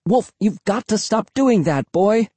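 high-pitched, chiding voice; scolding the dog. A narrator's reading. Narrator to dog who jumped up, high scolding. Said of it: "I told him".

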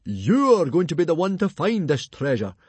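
high, loud and eager; bursting with news for his son; excited. A narrator's reading. Father tells Hiccup he'll find the treasure, excited and loud. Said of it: "said Stoic excitedly".

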